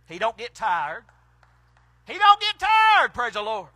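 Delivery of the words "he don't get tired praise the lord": preaching shout. Real, not acted. Preacher driving the point home to the church, hollering. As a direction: lift it up and holler it the second time. Repeats line, then builds.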